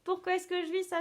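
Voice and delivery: ordinary talk, nothing marked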